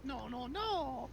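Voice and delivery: high squealing wails, drawn out; panicked villain. Pretend. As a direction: wail like a cartoon villain. Villain panics, voiced dramatically.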